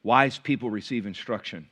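ordinary delivery

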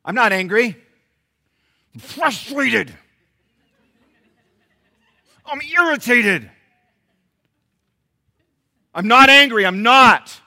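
high, hammed-up shout of denial. Angry churchgoer insisting he is not angry, comic shouting. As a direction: yell it as if insulted, drawing out the feeling word. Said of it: "Why are you raising your voice?".

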